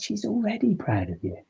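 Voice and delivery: soft and high, gently revealing; quietly reassuring coach; tender. Retold or imitated. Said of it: "He said".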